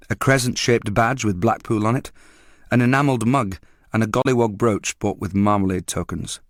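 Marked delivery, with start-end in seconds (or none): none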